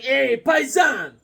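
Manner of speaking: put-on slow, high New York Italian accent; Italian greeting. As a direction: greet like a Little Italy local. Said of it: "They're like".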